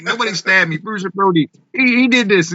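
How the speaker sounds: put-on a proud young boy protesting; excited kid. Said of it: "I'm like".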